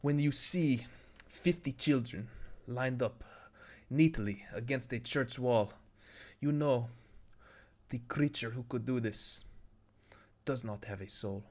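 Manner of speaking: put-on halting Slavic accent with heavy pauses; grave speech in a put-on Russian-style accent; Niko Bellic. Streamer playing the game character, grave Niko Bellic accent. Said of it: "I can just do a Niko Bellic accent".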